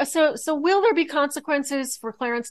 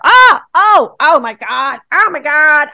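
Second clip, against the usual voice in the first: loud, high gasps of delight; real excitement, loud and high; excited discovery; 6 semitones higher, 12 dB louder than usual. Real, not acted. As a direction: exclaim as it all clicks into place.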